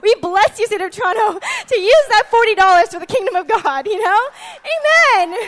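high, loud joyful blessing; excited mom. Retold or imitated. Mom blessing Toronto over parking tickets, loud and high. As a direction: burst out in happy praise. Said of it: "It's like".

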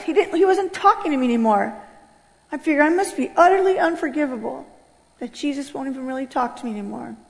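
ordinary talk, nothing marked

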